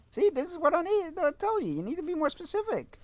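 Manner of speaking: high scolding. Real, not acted. Lecturing the voice.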